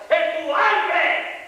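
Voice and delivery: high, driving pulpit shout; impassioned high-pitched sermon cry; full-force preaching. Real, not acted. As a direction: drive each phrase home at a shout.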